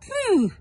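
very high loud pooh; loud high disgusted exclamation. Acted. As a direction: recoil from the stink. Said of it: "and Len said".

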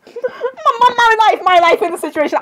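hammed-up exasperated outburst; mock despair. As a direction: wail it like a mock meltdown. Playful outburst, about to cry.

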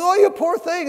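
put-on high, loud cooing old lady voice; doting old lady. Elderly lady fussing over her dusty parakeet, high cooing. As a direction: coo over the little bird like a fussing granny. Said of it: "she goes".

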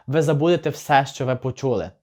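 ordinary talk

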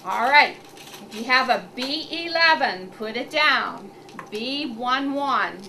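clear, measured number calling; bingo caller. Acted. Calls bingo numbers to clients.

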